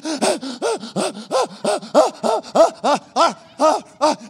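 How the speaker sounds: loud, high, exaggerated panting; acted running pants. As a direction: huff hard like a man running flat out. Acts the runner's hard breathing.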